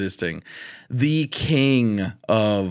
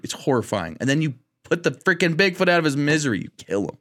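ordinary talk

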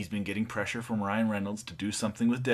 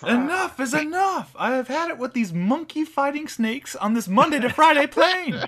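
Samuel L. Jackson impression; 5 dB louder than usual. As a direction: belt out the TV-dub line, hammed up. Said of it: "That actually aired on F X, as I quoted it".

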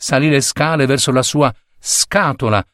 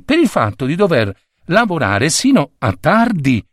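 usual speaking voice

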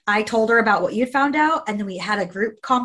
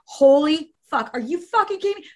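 retold outrage; 6 semitones higher than usual. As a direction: act out her stunned fury. Retold Avery's shocked text.

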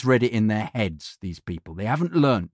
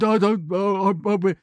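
mock stuttering; 8 semitones higher, slower than usual.

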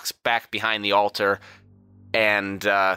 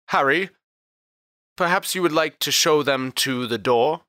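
polite dismissal; 6 semitones higher than usual. Acted. Lord addresses bailiff, politely dismissing guests.

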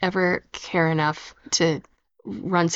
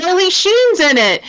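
Charlie Sheen voice; 12 semitones higher, 6 dB louder than usual. Retold or imitated. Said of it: "That's where I got the voice from".

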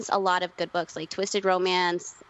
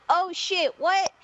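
a much higher, astonished mom yelp; mock surprised mom; 10 semitones higher, slower than usual. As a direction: pop up high as if stunned. Mom hears the news.

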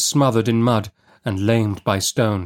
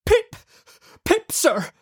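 put-on very high, timid child voice; frightened little boy; slower than usual. A narrator's reading. Pip answering the man who seized him, frightened. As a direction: answer as a scared small boy. Boy repeats name in fear.

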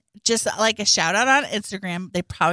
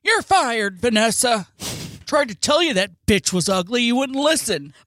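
mock boss voice; 4 semitones higher, 4 dB louder than usual. Cartoon voice firing Vanessa.

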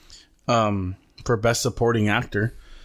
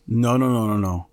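ordinary talk

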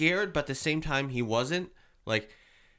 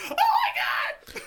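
high, loud, drawn-out shriek; mock frightened scream; 4 dB louder, much slower than usual. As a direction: scream it like a startled victim. Acting the frightened girls' reaction.

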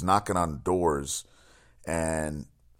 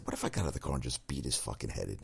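softer, fast grumbling under his breath; angry mutter to himself; 5 semitones lower, 9 dB quieter, faster than usual. Retold or imitated. Driver stuck beside a reckless kid, muttering angrily. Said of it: "and I said".

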